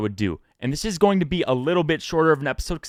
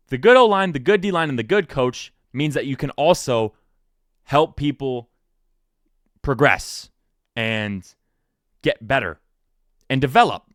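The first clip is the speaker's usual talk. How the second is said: usual speaking voice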